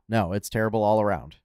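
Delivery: ordinary delivery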